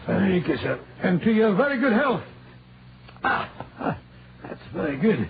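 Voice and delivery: a light put-on Scottish accent, rolling the r's. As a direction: play a canny Scot from Aberdeen, lightly. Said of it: "that delightful Scottish accent you sometimes assume".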